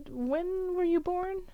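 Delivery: high, slow, sing-song question; curious question. Retold or imitated. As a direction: ask it sweetly, disarming the fight. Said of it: "be like".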